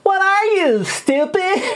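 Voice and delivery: put-on high, shrill-free squeaky anime girl; Asuka impression. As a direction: snap it like a bratty anime girl. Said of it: "Tiffany Grant saying".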